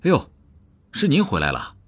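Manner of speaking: high-pitched, ingratiating "oh!" easing into welcome; cordial greeting. A narrator's reading. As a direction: greet a superior with friendly surprise. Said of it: "语调亲切地说". (语调亲切地招呼)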